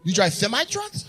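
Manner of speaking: "semi" said with th for s; mock lisped "semi"; echoed lisp. Echoing the man's lisped answer.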